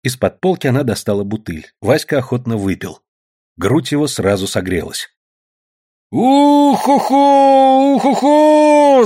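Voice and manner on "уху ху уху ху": very high, long drawn-out whoop; loud howling "oo-hoo"; wild high holler; whooping yell. A narrator's reading. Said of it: "заорал он".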